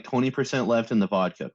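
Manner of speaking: usual speaking voice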